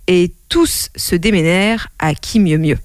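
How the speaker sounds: ordinary delivery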